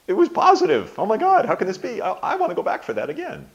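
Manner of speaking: very high, loud burst of disbelief; giddy, over-the-top elation, acted out; amazed delight. Acts out amazed delight after class.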